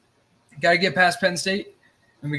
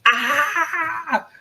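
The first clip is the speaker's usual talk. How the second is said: high excited shout; excited yell; 13 semitones higher, 3 dB louder than usual. Real, not acted. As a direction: let out a thrilled cry. Kickoff excitement.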